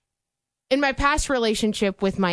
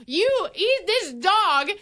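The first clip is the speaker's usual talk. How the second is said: mock boyfriend; 7 semitones higher than usual. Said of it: "He's like".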